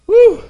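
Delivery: high, loud "Woo"; excited whoop. Real, not acted. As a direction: whoop for joy.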